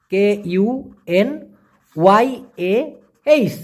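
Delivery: each letter said very slowly and apart; slow spelled-out K U N Y A H; spelling out letters. Shown as a demonstration. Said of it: "উচ্চারণটা হবে".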